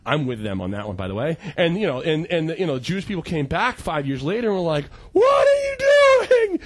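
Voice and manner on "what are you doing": high, loud outraged shout; shrieking disbelief at the mess; angry outcry. Retold or imitated. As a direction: yell at them in outrage. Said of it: "they were super angry".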